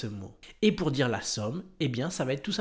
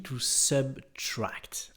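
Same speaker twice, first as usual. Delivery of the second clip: whisper; much slower than usual. Acted. Teacher reveals the word.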